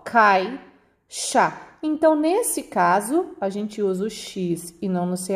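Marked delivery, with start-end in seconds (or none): 0.1-1.5 "caixa" as cai... xa, with a clear gap; two separate syllables in "caixa"; split into syllables. Shown as a demonstration. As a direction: hold cai together, then say xa. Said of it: "Estão numa sílaba só".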